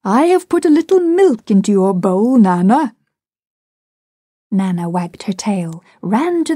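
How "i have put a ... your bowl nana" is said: higher, louder pet-talk; coaxing the dog. A narrator's reading. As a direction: sweet-talk the dog into drinking. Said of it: "he said, patting her".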